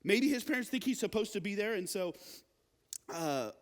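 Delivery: ordinary talk, nothing marked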